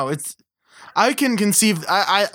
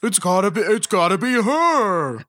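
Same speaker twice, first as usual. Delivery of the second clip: mock excitement; 4 semitones higher than usual. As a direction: play a silly sudden revelation, stretching the last word. Joins in the acted eureka moment.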